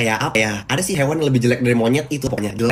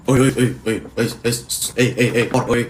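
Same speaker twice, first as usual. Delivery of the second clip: repeated rude oi calls in an odd voice; rude catcall. Retold or imitated. A rude stranger calling Kori, mock rude catcall. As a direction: call out oi like summoning an animal. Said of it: "dia manggilnya tuh kayak gini".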